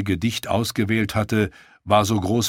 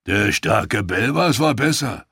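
boastful brute. A narrator's reading. Boastful fighter's voice.

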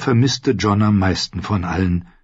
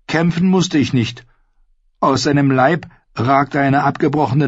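higher, earnest storytelling voice; young squire Ned; 6 semitones higher than usual. Acted. Ned recounts a battle to Arya, earnest and higher. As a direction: voice a squire recounting his deed, pitched up.

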